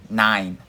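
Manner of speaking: "Nein." said firmly as German nein. Shown as a demonstration. Joking to live viewers, firm German "Nein.". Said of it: "I'll say it in German".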